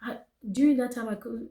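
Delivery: normal talking voice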